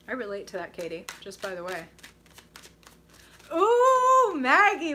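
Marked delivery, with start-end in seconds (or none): 3.5-4.9 loud, high swooping ooh; delighted surprise. Real, not acted. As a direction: pounce on it with delight. Delighted by a viewer's question.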